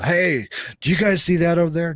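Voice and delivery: loud, high-pitched alarmed call-out; excited yell. Shown as a demonstration. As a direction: yell it out to the group as a bad example. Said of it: "don't point at it and don't yell".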